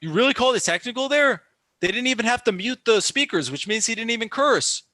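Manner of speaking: high, loud indignant protest; outraged rant. Real, not acted. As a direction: argue the referee's call in real disbelief. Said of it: "Sorry, my little rant".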